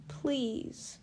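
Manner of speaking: high, soft, falling please; tearful plea. A narrator's reading. Bella begging Edward, high tearful plea. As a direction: beg through tears. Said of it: "my plea muffled against his skin".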